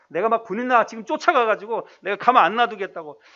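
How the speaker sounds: loud acted husband's threat; mock angry threat. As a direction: pretend to storm off and confront her. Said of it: "막 화를 버럭 내고". (버럭 화내며 벼르는 남편 흉내)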